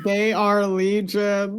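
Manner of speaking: slow, dramatic, solemn delivery; slow solemn repeat. Acted. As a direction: intone it slowly and gravely. Repeating line for laughs.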